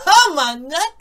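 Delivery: high, loud mock squeal of delight; mock excited squeal. Recalling his reaction to the hot scene, mock squeal. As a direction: squeal it like a hyped fan.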